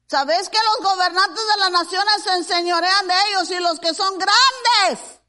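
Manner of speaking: loud, high scripture proclamation; rushing forward, then a long, loud final word; preaching. Real, not acted. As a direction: declaim the verse, stretching the last word. Proclaims scripture at full force.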